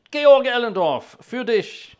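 loud, high announcement in German; called-out dedication. Real, not acted. Organist dedicating the piece to Georg Ellendorf, calling out.

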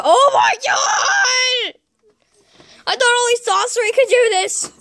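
high, loud amazed outcry; astonished. Acted. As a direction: gasp it out in wonder. Amazed at handshake.

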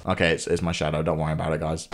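low, hushed reassurance; sheepish quiet aside. Real, not acted. Player to viewers after a shadow scare, sheepish and hushed. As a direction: drop the voice right down, soft and low. False alarm over own shadow.

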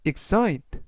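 "excite" said clearly, ik-SITE, stress on the second syllable; English pronunciation demo. Shown as a demonstration. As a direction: model the word "excite" for learners. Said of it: "라고 발음하시면 되겠고요". (영어 발음 시범으로 "excite"를 또박또박)

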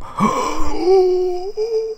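huge high gasp of shock; a sharp high gasp of disbelief; stunned gasp at the screen. Real, not acted. Shocked at natural gas reserves.

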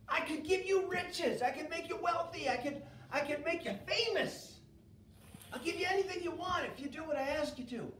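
a king bargaining impatiently; demanding king. Acted. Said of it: "And Balak says to him".

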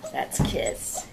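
usual speaking voice

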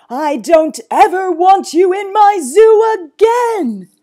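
slow, high, furious shout. Acted. Zoo owner banning the boy, furious. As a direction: bellow every word in fury. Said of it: "enraged Sir Basil further".

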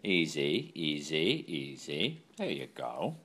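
soft, low calming murmur; soothing low. Real, not acted. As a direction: settle the dog down gently.

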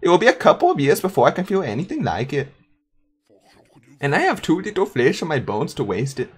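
put-on German accent. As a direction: play the chained undead Gunter with a German lilt. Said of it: "with this accent".

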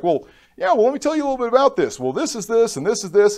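high, fast, lightly mocked eagerness; a hint of a keen teacher rattling on; eager nurse.

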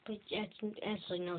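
ordinary talk

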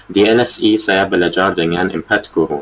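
Indonesian with a non-native accent; careful Indonesian from a foreign learner; foreigner speaking Indonesian. Real, not acted. Learner introducing himself in Indonesian.

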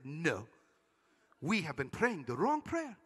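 retold refusal, deep and weighty; grave fatherly refusal. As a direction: imitate a father solemnly saying no. Retold pastor father firmly refusing.

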